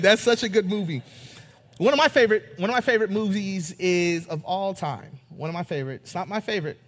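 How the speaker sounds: ordinary talk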